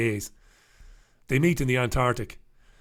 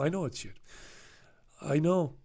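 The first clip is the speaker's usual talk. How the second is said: normal talking voice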